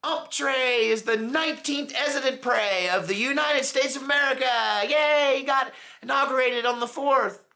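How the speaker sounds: sarcastic high, loud cheering; mock cheering. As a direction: cheer like a party-goer at New Year's. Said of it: "'cause I'd be like".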